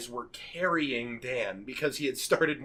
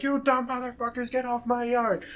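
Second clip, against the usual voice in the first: put-on high-pitched old man grumbling a scolding; angry old man; 8 semitones higher than usual. Old man chasing kids off his yard, mock angry. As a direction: imitate an old man telling off trespassers, pitched way up. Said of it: "there's this old man like".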